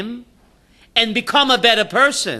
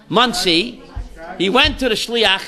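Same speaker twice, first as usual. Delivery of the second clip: usual speaking voice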